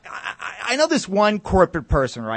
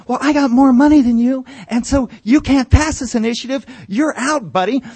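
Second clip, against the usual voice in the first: louder, higher gloating neighbor; mock shouting match; 8 semitones higher, 5 dB louder than usual. Corporate neighbor taunting the comedian, loud and higher. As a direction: jeer it at him, pushing. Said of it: "shouting match".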